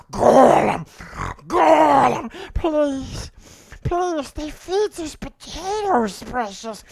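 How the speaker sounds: gulping then crying please; whimpering Smeagol. Acted. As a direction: beg through tears like Smeagol. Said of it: "that's my Golem impression".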